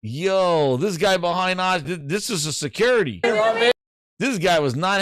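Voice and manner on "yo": ordinary talk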